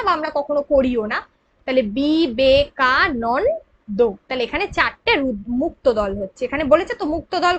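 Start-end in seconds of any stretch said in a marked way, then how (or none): 2.0-4.1 "বি, বে, কা, নন, দো" as five separate beats; syllable by syllable. Shown as a demonstration. Teacher recapping the division, "বি, বে, কা, নন, দো" syllable by syllable. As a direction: break it into syllables, keeping নন whole. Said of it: "আলাদা ভেঙে উচ্চারণ".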